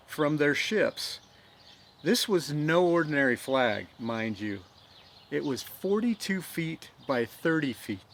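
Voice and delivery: dramatic storytelling voice, rising with the battle; story narration. A narrator's reading. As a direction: tell it like a stirring tale of history. Storyteller narrates Fort McHenry history.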